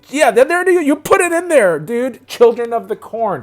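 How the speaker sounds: real thrill of remembering the name; excited eureka. Real, not acted. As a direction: blurt it out as it clicks. Suddenly remembering the movie.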